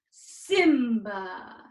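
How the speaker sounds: put-on proud young king; proud deep Simba. Shown as a demonstration. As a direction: stand tall and call Simba. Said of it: "get deep in our voice".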